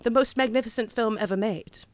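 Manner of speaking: hammed-up British accent with swelling emphasis; bad London accent. Host giving a verdict on the film, bad London accent. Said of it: "talk in a bad accent".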